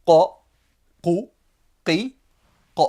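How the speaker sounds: Arabic qaf demo; emphatic qaf. Shown as a demonstration. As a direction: drop the Q to the very back of the throat. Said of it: "une lettre emphatique".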